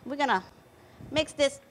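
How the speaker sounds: usual speaking voice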